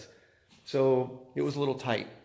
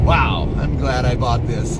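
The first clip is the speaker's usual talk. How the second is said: excited; 2 semitones higher, 13 dB louder, slower than usual. Real, not acted. Impressed by throttle response.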